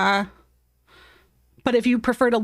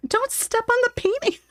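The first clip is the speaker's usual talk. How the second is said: much higher sing-song plea: "Don't step on the painting"; high voice for the cat; 13 semitones higher than usual. Real, not acted. Owner pleads with her cat in a high, cutesy voice. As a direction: coax the pet in a high, cutesy voice.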